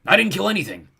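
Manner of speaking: high, loud goblin denial; indignant goblin yell; protesting goblin. A narrator's reading. Goblin shouts denial in quarrel.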